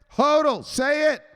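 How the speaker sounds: high, loud barked orders; abusive enforcer. Acted. Enforcer bullying a weak hand, loud high commands. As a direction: bark it like a bullying drill boss. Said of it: "He's getting abusive tonight".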